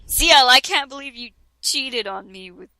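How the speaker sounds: normal talking voice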